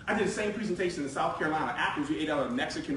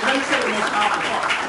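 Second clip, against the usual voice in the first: louder, urgent retold plea; emotional retelling; 3 semitones higher, 9 dB louder than usual. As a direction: press the point, voice lifted with feeling. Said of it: "He's emotional because I would be emotional".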